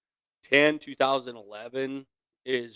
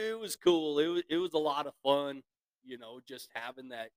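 ordinary talk

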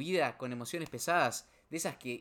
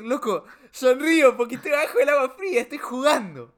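much higher, loud and beaming; joyful shout; 11 semitones higher, 13 dB louder than usual. Retold or imitated. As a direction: grin through the icy water and shout it. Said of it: "decir, decir". (grito alegre, mucho más agudo y fuerte)

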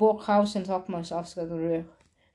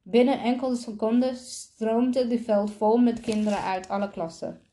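ordinary delivery, nothing marked

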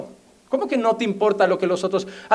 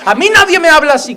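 mock indignation; 6 semitones higher, 6 dB louder, faster than usual.

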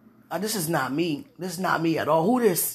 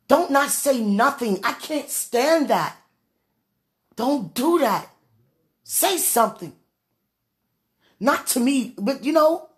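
raised, higher-pitched insistent demand; getting loud; 5 semitones higher, 6 dB louder than usual. Real, not acted. Woman demanding her man say or record something, getting loud. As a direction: get loud and press every phrase. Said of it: "If I sound like I'm getting loud".

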